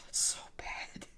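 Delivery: slow whisper, barely any voice; whispered aside. Real, not acted. Fan regretting his harsh remark about a player, whispered aside. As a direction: whisper it to yourself, drawn out.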